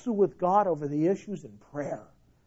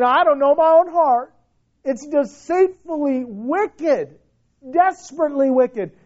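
anguished lament; 5 semitones higher, 5 dB louder than usual. Acted. Preacher voices Jeremiah's lament.

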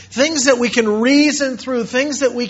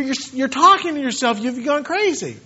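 usual speaking voice

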